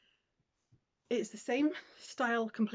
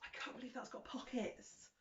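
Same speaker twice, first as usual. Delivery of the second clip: whispering; 3 semitones higher, 13 dB quieter than usual. Real, not acted. Whispered disbelief.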